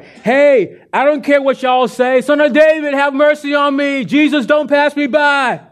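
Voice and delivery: high, loud desperate calling. Retold or imitated. Blind beggar yells over the hushing crowd, high and loud. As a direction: yell over the crowd, refuse to be silenced. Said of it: "he cried all the more".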